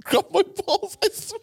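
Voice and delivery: high, loud disbelief; shocked outburst. Real, not acted. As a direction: blurt it out in disbelief. Shocked telling of being grabbed.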